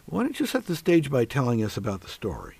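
ordinary talk, nothing marked

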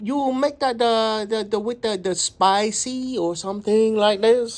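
put-on customer ordering in a thick Chinese accent. Customer asking restaurant about spice, in a Chinese accent. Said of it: "we're gonna do a Chinese accent".

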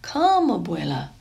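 usual speaking voice

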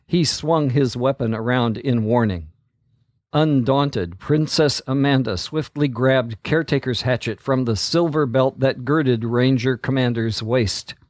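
usual speaking voice

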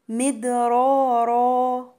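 "مدرارا" chanted slowly with full, thick r sounds; heavy raas. Shown as a demonstration. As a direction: thicken both ر in "مدرارا" and hold the final aa. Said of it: "والراءات دي هتبقى مفخمة". ("مدرارا" بتفخيم الراءات ومد الألف)

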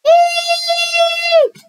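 very high, sustained shriek; yelled eek. A narrator's reading. First scared kid screams at the others, piercing squeal. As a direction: squeal a long storybook "eek". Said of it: "yelled Sam".

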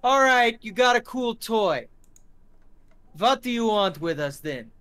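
high, loud uneasy bravado; nervous thug. Acted. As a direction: try to sound tough but rattled. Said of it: "He goes".

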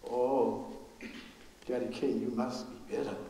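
soft, low voiced sympathy; a low murmur of condolence; hushed pity. Mocking questioners' pity.

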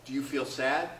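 ordinary talk

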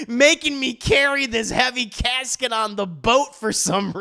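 hammed-up disgruntled workman; mock griping worker. Worker's complaint continues after laugh.

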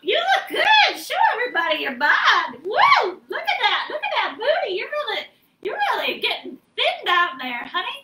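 high quavery granny voice; playing a sweet old grandma; old lady voice. Granny fussing over friend.

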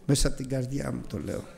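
ordinary talk

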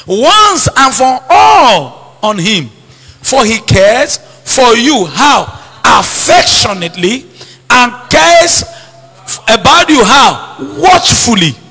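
slow, loud, rising sermon delivery; building pulpit declaration; fervent preaching. Acted. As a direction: proclaim it to the whole church. Preaching Scripture at full force.

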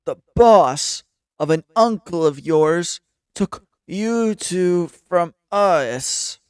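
slow, loud, drawn-out accusation; emphatic, slow villain taunt; menacing villain. Acted. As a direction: lean on each word slowly as a game villain. Voices a villain confronting the kids.

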